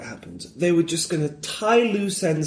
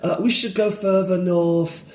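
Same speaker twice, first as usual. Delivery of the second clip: put-on a light Yorkshire accent, slow and dopey; lazy Yorkshire drawl for a bored hero. Mocking Jon Snow in a light Yorkshire accent. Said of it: "I can do the accent really well".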